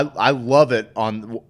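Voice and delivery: ordinary delivery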